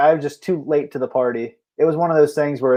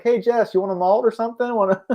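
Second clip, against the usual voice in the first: lightly voiced wife, higher pitch; hint of wife; 7 semitones higher than usual. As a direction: pretend wife making a casual offer. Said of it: "What if your wife was like".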